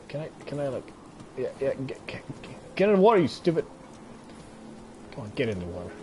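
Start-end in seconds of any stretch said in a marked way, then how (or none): 2.7-3.6 louder, higher scolding order; exasperated yell. Real, not acted. Player yelling at a gazelle to get in the water. 5.1-5.9 quieter, lower gentle urging; soft coaxing. Real, not acted. Player coaxing a gazelle into the water, soft urging.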